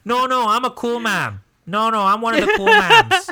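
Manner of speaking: high, loud Midwestern mom; hammed-up Midwest cool-mom voice; Midwest mom accent. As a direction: play a proudly protesting Midwest mom. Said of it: "my most favorite American accent".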